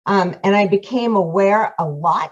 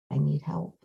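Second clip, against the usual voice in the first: soft, near-breaking admission of need; barely voiced, letting the words slip out; quiet confession; 3 semitones lower, 10 dB quieter than usual. Retelling asking for help in class.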